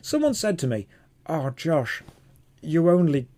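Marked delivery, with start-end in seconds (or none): 1.3-3.3 lightly put-on whinger's voice; stock critic voice. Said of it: "This is the voice I do".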